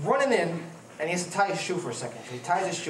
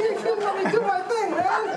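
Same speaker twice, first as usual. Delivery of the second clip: high, louder, laughing mock protest; laughing retort; 5 dB louder, slower than usual. Telling his partner Barry to back off, high and laughing. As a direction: play the fed-up one while cracking up.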